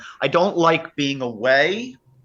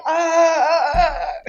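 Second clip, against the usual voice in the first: high, loud panicked wails; hammed-up high cries of horror; mock horrified cry; 5 dB louder than usual. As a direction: shriek like the comics watching the fall. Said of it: "we're all like".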